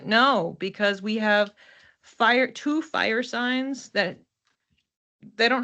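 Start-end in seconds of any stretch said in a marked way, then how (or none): none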